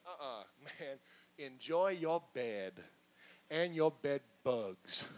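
low, slow, laughing brush-off; slow low taunt with a laugh; dismissive. Acted. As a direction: sneer it, amused and done. Dismissive God if like us.

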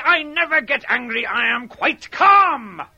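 loud, strained, slowing insistence; angry denial. Acted. JD loudly insisting to Sarah Jane he never gets angry. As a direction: deny it while boiling over. JD denying anger, fuming.

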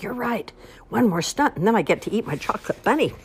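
perking up. Acted. Ian perks up.

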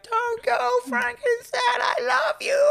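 put-on very high, wailing child's cry; mock sobbing high-pitched farewell to the monster; crying plea. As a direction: shriek it through tears like a heartbroken little girl. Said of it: "Phoebe's like".

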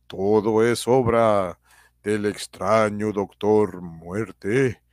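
slow dramatic cliffhanger narration; old radio serial announcer. A narrator's reading. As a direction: tease the next episode slowly.

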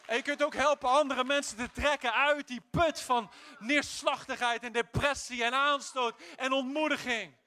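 loud, rousing exhortation; full-force preaching; preaching shout. Acted. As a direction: build to a victorious cry.

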